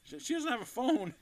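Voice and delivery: high, loud stammered excuse; retold flustered protest. Man explaining she has no phone, high flustered mock protest. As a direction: protest in a squeaky voice, amused.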